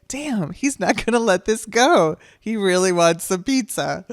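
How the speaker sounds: tickled that he won't let it go; amused exasperation. Retold or imitated. Amused inner thought about husband.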